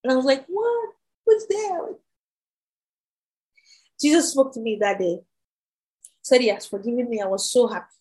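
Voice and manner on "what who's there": high startled question. Retold or imitated. Student hears Jesus speak, high startled question. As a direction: ask who's there in shock.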